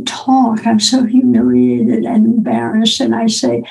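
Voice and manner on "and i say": ordinary talk, nothing marked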